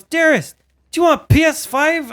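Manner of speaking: squeaky excited wife impression; imitating wife. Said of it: "She goes".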